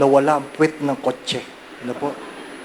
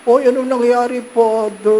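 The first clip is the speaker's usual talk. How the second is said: higher, louder alarmed question; startled secretary; 8 semitones higher, 5 dB louder than usual. Retold or imitated. Secretary seeing the wrecked car, startled. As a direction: gasp it out at the damage.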